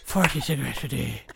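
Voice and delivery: deep, gruff mock smoker voice; Liam Neeson voice. Joking about the actor's smoking, deep gruff imitation. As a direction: drop very low and put on the gruffness. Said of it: "You gotta do it gruffer".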